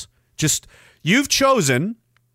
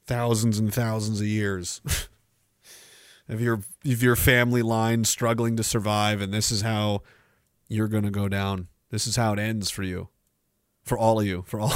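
ominous low voice; 9 semitones lower, 7 dB quieter than usual. Acted. Low doom-laden address.

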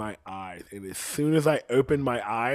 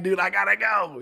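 higher, louder hurried reply; telling the friend he has to leave; urgent; 8 semitones higher, 5 dB louder than usual. Retold or imitated. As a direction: push it out in a rush.

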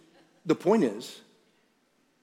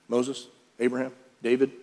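ordinary talk, nothing marked

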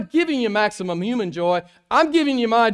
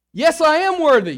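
higher and louder, God declaring himself; voicing God; 9 semitones higher, 9 dB louder than usual. Preacher voicing God to the congregation, high and loud. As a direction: boom it out as the Almighty.

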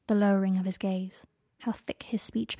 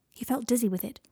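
plain reading voice

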